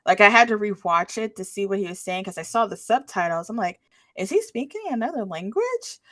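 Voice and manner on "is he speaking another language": retold puzzlement. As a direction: ask it higher, puzzled by what you hear. Retold puzzled reaction to subtitles.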